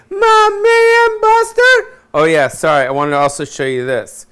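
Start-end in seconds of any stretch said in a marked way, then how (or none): 0.1-2.0 very high, loud wailing call, slow; falsetto cry to dog. Pretend. Falsetto wail calling out to the dog Buster. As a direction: wail the dog's name in falsetto.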